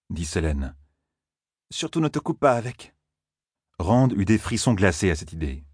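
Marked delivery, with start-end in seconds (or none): 1.7-2.9 Selene's voice. A narrator's reading. Selene voiced in read story.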